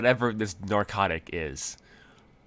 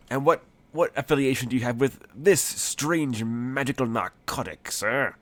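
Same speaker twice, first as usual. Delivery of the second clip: higher, hammed-up accusing questioning; theatrical interrogator; 4 semitones higher, 3 dB louder than usual. Boss questioning the unamused leprechaun about drugs, theatrical interrogation. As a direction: stretch out the accusation like a showy detective. Game master acts a dramatic interrogator.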